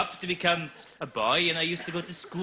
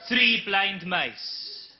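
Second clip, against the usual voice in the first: even slower solemn repeat; repeated poem; 4 semitones higher, 4 dB louder, much slower than usual. Acted. Comic repeating his poem, deadpan slow. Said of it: "a couple of poems".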